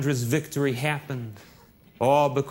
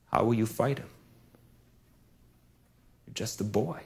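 low, soft, doubting king; lightly voiced king, quiet and low; King Saul, doubtful; 5 semitones lower, 6 dB quieter than usual.

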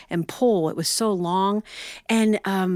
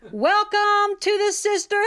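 balcony woman; 11 semitones higher than usual. Retold or imitated. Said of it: "one woman in the balcony said".

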